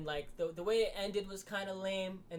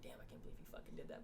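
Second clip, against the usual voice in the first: fast, much softer, whispered; whispered aside; 5 semitones lower, 13 dB quieter, faster than usual. Retold or imitated. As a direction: mutter it under your breath. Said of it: "He's like".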